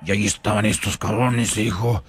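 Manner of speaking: ordinary talk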